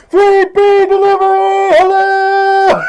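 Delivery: high, loud, stretched-out holler; yelling. Retold or imitated. Driver down on the street calling up, yelling. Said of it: "he can't hear me yelling".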